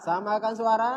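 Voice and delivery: put-on high drawn call to the boys. Shown as a demonstration. Teacher calling boys to echo, high call. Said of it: "Coba putra".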